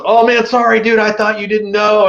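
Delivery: a hint of a flustered guy excusing himself; apologetic stranger.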